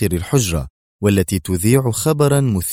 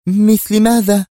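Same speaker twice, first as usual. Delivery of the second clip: quick eager question; 8 semitones higher, 4 dB louder than usual. A narrator's reading. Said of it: "سألتها ريهام في سرعة واهتمام".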